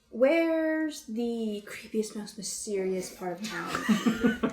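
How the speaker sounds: very slow, stretched-out question; slow, drawn out. Acted. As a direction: savor each word as you ask. In-character question to the barkeep.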